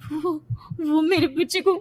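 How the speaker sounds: high, halting, crying voice; frightened daughter sobbing to her mother; tearful panic. Acted. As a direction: stammer it out in fear for your baby.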